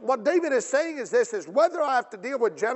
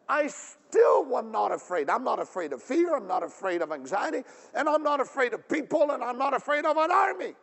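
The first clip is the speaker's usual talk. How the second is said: defiant declaration; 3 semitones higher than usual. Acted. Preacher voicing David facing an army, building defiance. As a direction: escalate each "not afraid" to a peak.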